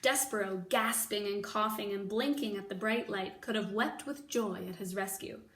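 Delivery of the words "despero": ordinary delivery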